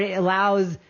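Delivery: normal talking voice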